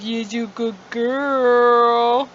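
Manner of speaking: drawn-out loving praise for a good cat; pet praise. Real, not acted. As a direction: stretch every word to praise the pet.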